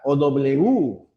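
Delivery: normal talking voice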